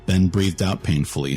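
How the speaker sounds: ordinary delivery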